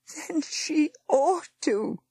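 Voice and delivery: high, slow, tearful line; wept. Acted. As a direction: weep out the warning. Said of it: "wept Missus Gunning".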